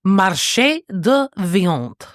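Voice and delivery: slow careful French, Marché de Viande; French accent. Shown as a demonstration. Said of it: "the French is so good".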